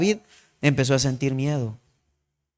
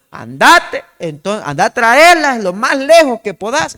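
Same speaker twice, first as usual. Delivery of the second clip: louder, higher call across the field; 6 semitones higher, 8 dB louder than usual. Retold or imitated. Jonathan showing David the shouted signal, calling out.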